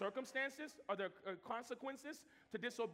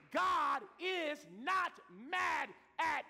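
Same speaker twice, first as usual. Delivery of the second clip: preaching shout; 4 semitones higher, 5 dB louder, slower than usual. Real, not acted. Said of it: "proclaimed loud".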